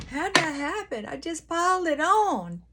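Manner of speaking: playful high exclaim over the makeup; mock dramatic. Real, not acted. Laughing at her own heavy makeup, high and playful. Joking about piling on makeup.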